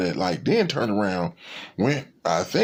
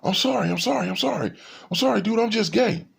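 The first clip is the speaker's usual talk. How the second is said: high, flustered mock apology; imitating apology; 12 semitones higher, faster than usual. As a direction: play the stranger backing off, much higher. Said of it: "He started backing up going".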